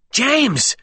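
high frantic cry; shrill, frantic. A narrator's reading. Alexander calls through the gloom to James, shrill and frantic. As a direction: call out shrilly in alarm. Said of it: "shrill and frantic".

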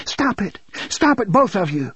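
high, pleading cry near tears. Acted. Mother pleading between husband and son, near tears. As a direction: beg them to stop. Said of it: "Jake's mother cried".

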